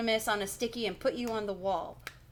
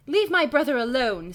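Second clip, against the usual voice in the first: loud, higher protective yell; 6 semitones higher, 9 dB louder than usual. A narrator's reading. Sister to the man gripping her brother, yelling. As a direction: shout it to defend your brother. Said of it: "Stacy yells".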